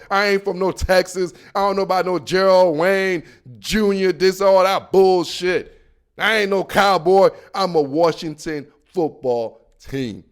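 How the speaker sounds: ordinary delivery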